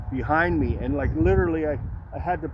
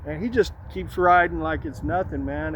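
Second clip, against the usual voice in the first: ordinary talk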